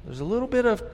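ordinary talk

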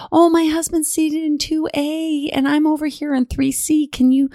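high, slow mock wife voice; imitating the wife. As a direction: lift into a high pleading wife. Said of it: "she's like".

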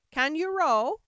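loud, high demanding question; abrupt loud character voice; brusque Sheep. A narrator's reading. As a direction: call it out as the bossy Sheep.

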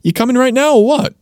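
high-pitched, impatient-style "or what?"; casual pressing question. Acted. Teacher translating what the slang feels like, high pressing question. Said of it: "it kind of feels like I'm saying".

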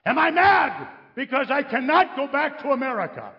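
high, loud, hammed-up indignation; exaggerated outraged protest; mock outrage. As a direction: thunder it in mock fury. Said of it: "what am I mad about?".

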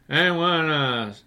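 put-on slow, drawn-out old man; William Burroughs. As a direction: drag every word out like a tired old man. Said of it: "You know, like that".

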